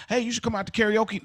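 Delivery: ordinary delivery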